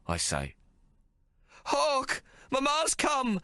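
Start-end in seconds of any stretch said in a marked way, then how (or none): 1.7-3.4 high desperate shriek; screaming. Acted. Mary calling to her captive son, screaming high. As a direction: scream it to reach him, pitched way up. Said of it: "she screams".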